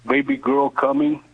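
ordinary delivery

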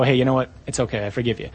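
low, soft, easygoing tone; casual forgiveness. Acted. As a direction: let it go quietly, as with a friend after a small spat. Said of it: "This isn't like Steve comes up to me".

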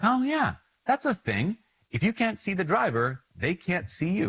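high, bright mock dawning; sudden realization. Remembering his driving lesson, imitating a surprised realization. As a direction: pitch it up like it just clicked. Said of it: "I was like".

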